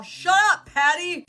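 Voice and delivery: hammed-up dismissive snap; mock telling-off. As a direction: playfully yell back at a nagging mom. Telling mom off, retold.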